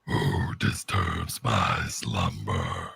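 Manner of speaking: very low, slow, rumbling stone voice; Frank Welker's cave voice. Retold or imitated. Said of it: "my impersonation of Frank Welker's".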